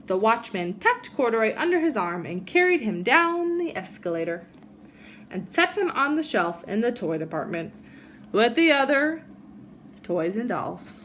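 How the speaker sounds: put-on gentle read-aloud for children; reading a story to a class; storybook reading. A narrator's reading. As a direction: tell it like a bedtime picture book. Reads picture book to kids.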